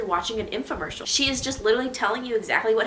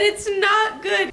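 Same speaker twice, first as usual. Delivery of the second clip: exasperated; 12 semitones higher, 4 dB louder, much slower than usual. Real, not acted. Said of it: "exasperated verdict".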